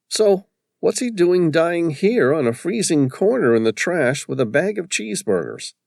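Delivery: lightly voiced colleague, wry; character voice. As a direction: lift the voice into a character. Character dialogue in the story.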